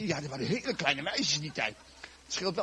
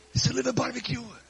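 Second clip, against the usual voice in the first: high, playful offer; cheery invitation; 6 semitones higher than usual. Acted. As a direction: pitch it up as a fun suggestion. Repeats invitation brightly for laugh.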